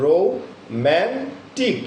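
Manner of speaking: Romantic slow, man pushed forward; stress on MAN. Shown as a demonstration. Teacher showing students the stressed romantic, slow and emphatic. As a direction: draw out romantic, hitting man hard. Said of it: "man শব্দের অর্থে stress থাকার কারণে".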